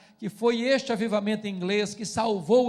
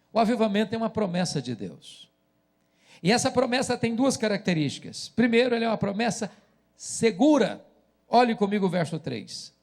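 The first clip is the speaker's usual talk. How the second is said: usual speaking voice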